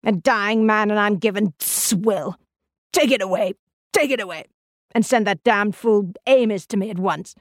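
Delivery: crying out in an aged, cranky voice; an old invalid's indignant outburst; shrill old man. A narrator's reading. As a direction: play a peevish old man demanding service. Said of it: "a voice high and shrill with age".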